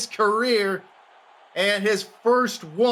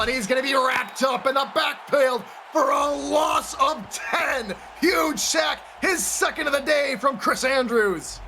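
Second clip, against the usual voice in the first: rising live sack call; excited play-by-play; 5 semitones higher than usual. Real, not acted.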